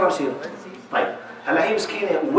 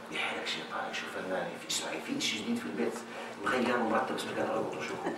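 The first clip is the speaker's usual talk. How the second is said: hushed teasing; 8 semitones lower, 5 dB quieter than usual. Retold or imitated. Playfully mocking husband and wife.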